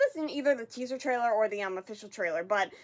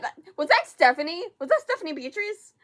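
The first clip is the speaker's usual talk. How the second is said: higher, louder astonished question; amazed, pitched-up double take; stunned recognition; 6 semitones higher, 6 dB louder than usual. Real, not acted. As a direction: blurt it out in rising disbelief.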